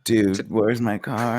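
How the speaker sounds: very slow, drawn-out movie catchphrase; mock stoner quote. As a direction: stretch it out like a dazed slacker. Quotes a stoner comedy title.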